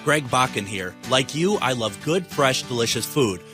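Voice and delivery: upbeat radio ad read. Acted. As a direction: pitch it like a friendly sponsor. Host reads sponsor ad.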